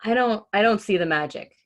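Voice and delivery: plain reading voice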